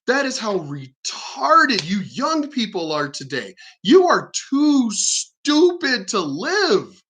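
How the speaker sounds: slow, emphatic scorn, pitch swooping high; drawn-out, contemptuous exasperation; exasperated rant. Real, not acted. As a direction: stretch each word in disbelief. Ranting at young people's incompetence.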